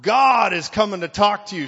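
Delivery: slow, loud mock divine rebuke; hammed-up, drawn-out heavenly condemnation; booming voice of God. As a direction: thunder it out like an angry God. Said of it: "that was the voice of God for me".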